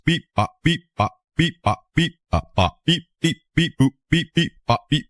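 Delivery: playful robot-like beep bop chant; silly beeping. Pretend. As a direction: chirp the beeps like a toy robot. Imitating robotic beeps while working.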